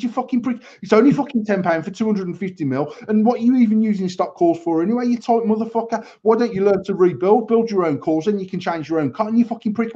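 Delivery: imitating an irate follower, pitched high; mock a ranting fan, high-pitched and indignant; mad and angry. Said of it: "They're getting all mad and angry".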